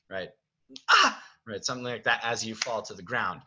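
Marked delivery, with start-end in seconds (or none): 0.9-1.2 very high, loud short yelp; yelp of pain. Shown as a demonstration. Director shows Belle's yelp as she falls, high pained cry. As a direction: let out a sharp pained cry. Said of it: "like a yelp of pain".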